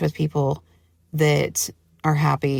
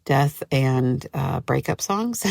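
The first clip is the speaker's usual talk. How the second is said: ordinary talk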